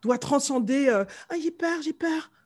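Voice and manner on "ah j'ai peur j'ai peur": higher, softer put-on timid voice; mock fear. Voicing someone scared of going into cold sea, mock fear. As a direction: act out a mild little fright. Voices the fearful hesitant swimmer.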